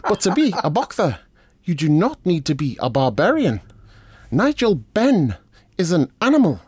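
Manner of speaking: pompous boxer impression. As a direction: pronounce it like a lofty orator. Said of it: "I'll do an impression".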